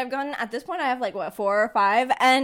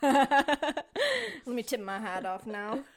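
slow, softer country drawl through a laugh; amused mock-cowboy twang; Southern twang; 7 dB quieter, much slower than usual. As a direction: chuckle out a put-on Southern farewell. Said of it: "keep on the southern twang".

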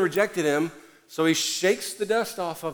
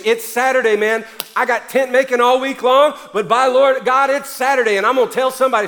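loud, higher, eager mission cry; fired-up Paul; 6 semitones higher, 8 dB louder than usual. Retold or imitated. Paul telling himself to go preach, loud and fired up. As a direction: burst out ready to preach.

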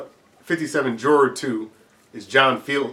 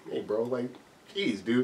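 meek nervous voice; 9 dB quieter than usual. Retold or imitated. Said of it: "he's like".

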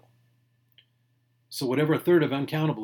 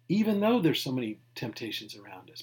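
ordinary delivery

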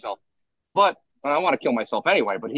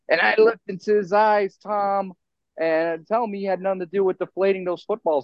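mock announcer; 7 semitones higher than usual.

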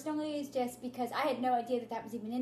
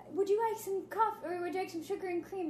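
put-on boy's voice pitched a little higher, courteous; polite little boy; 6 semitones higher than usual. Boy host offering sugar and cream, lightly higher. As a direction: offer the coffee like a tiny butler. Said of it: "he's just like".

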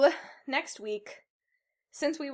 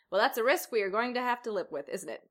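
ordinary talk

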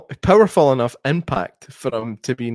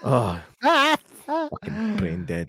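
exasperated drawl; 4 dB quieter, much slower than usual. Real, not acted. Exasperated at the slang.

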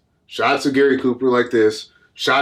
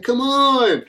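very high mock plea; Babe Bennett protesting; 12 semitones higher than usual. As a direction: imitate a drawn-out, high-pitched objection. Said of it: "Babe Bennett's like".

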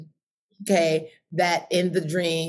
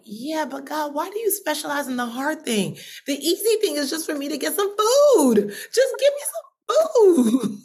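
whiny pleading; 9 semitones higher than usual. Retold or imitated. Hungry woman pleading with God, comic tearful whine.

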